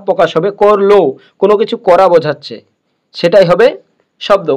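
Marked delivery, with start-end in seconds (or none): none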